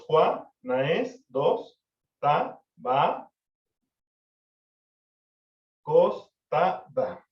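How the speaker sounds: "Juan, Naes, Ta, Ba, Cos, Ta, Da" split into separate syllables, with "Naes" kept as one; counting lyric syllables. Shown as a demonstration. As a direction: tap out each syllable of "Juana estaba acostada", one beat per syllable. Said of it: "Tenemos siete sílabas líricas".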